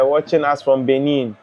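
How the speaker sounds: usual speaking voice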